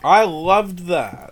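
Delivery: loud, drawn-out enthusiasm; emphatic delight. Real, not acted. Recalling the cardboard chocolate pack, emphatic delight. Fond emphatic memory of packaging.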